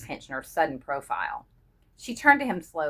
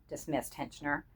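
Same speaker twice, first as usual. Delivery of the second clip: ordinary delivery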